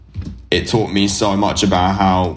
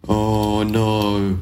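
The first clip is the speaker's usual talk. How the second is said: long drawn-out mock moan; 2 semitones higher than usual. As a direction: imitate complainers with a droning whine. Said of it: "they start fucking moaning".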